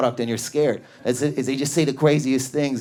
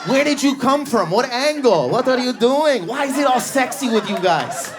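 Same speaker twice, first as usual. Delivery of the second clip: high-pitched flamboyant Persian man; sultry-silly flamboyant Persian impression; 6 semitones higher than usual. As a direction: imitate a showy Persian salesman, pitched up. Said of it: "Persians are the flamboyant Middle Eastern".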